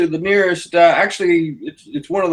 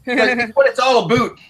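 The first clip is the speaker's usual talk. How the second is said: higher, hammed-up Canadian accent; mock Canadian accent; 6 semitones higher than usual. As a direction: play up a Canadian accent for laughs. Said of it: "How do we do Canadian accent, eh?".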